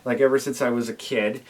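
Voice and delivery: usual speaking voice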